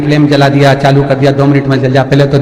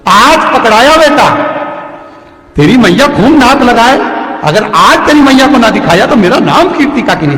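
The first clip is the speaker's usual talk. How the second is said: aunt catching thief; 6 semitones higher than usual. Acted.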